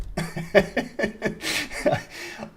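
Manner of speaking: a long, high, airless wheeze of laughter; breathy wheezing laugh. Real, not acted. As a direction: let the laugh run out with no voice. Laughing at his own story.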